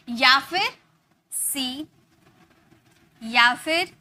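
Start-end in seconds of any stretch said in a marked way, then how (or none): 1.3-1.9 सी with a held ee, softly; pronunciation model. Shown as a demonstration. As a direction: model सी slowly as a lone word. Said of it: "इसकी pronunciation की जाएगी". (सी में लंबी ई का उच्चारण)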